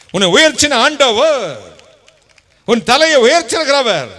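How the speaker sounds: loud, high rhetorical preaching; fervent preaching. Acted. Preacher to congregation, loud rhetorical questions. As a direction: hammer each question home.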